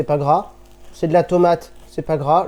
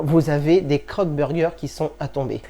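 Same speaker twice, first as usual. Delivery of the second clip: ordinary talk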